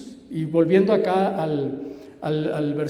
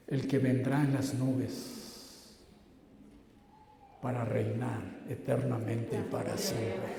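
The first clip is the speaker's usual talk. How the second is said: low, soft reflective sermon voice; hushed preaching; 5 semitones lower, 8 dB quieter than usual. Real, not acted. Preacher telling listeners of Jesus' favorite name, hushed and low. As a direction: let it sink lower and softer toward the end.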